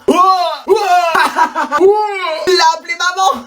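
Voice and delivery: éclat de rire moqueur, crié; moquerie en riant. Acted. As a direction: se moquer d'un camarade en riant fort. (élèves qui se moquent du camarade, en riant aux éclats)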